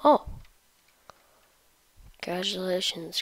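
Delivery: ordinary talk, nothing marked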